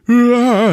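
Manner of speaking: high, loud imitated yell; mock scream. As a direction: let out a hammed-up 'Ah'. Killer fleeing apartment, retold.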